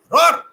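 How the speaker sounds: big high shouted roar; big lion showing the cub how to roar, loud. Acted. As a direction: let out a mock lion roar.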